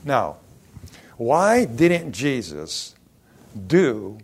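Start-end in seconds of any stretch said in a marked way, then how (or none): none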